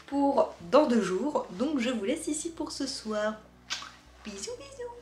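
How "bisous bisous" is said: voix aiguë mignonne. Real, not acted. Au revoir mignon aux abonnés.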